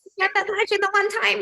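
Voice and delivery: high-pitched nagging voice in the head; scolding inner voice. Retold or imitated. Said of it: "that's my voice is like".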